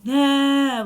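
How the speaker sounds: loud, high imitated whining cry; mock toddler wail. Mother mimicking her son's loud crying wail. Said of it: "like, loud".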